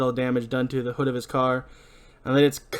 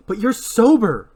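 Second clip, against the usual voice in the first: loud, high, drawn-out protest; high-pitched pleading argument; insistent objection; 9 semitones higher, 8 dB louder, slower than usual. Acted. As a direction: object with a raised, stretched voice. Julie argues with Ray.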